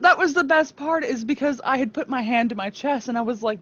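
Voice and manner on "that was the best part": ordinary delivery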